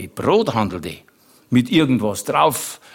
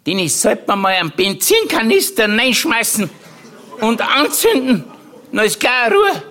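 high-pitched indignant aunt impression; Tante Mari; 10 semitones higher than usual. Comedian quoting his aunt on refugee neighbours, high mimicked rant. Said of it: "die Tante Mari".

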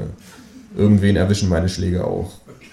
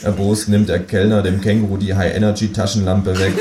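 usual speaking voice

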